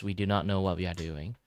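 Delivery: ordinary delivery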